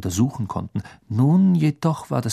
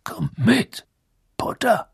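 growling; 12 semitones higher, slower than usual. Acted. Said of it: "knurrte er".

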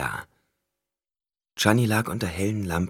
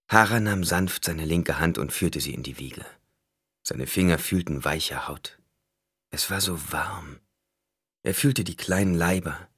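ordinary delivery, nothing marked

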